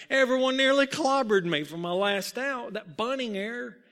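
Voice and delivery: high, forceful protest; exclaiming in disbelief. A narrator's reading. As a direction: protest the compliment with incredulity. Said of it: "Muggsy exclaimed".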